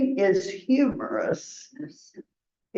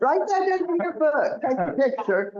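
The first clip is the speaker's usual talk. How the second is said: playful; 6 semitones higher, faster than usual. Real, not acted. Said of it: "teasing exclamation".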